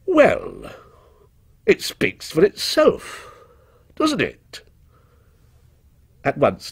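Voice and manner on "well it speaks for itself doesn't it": halting, much higher-pitched hedge; embarrassed effort. A narrator's reading. Embarrassed witness asked to judge a vase, effortful. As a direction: force it out in awkward pieces. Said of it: "said with an effort".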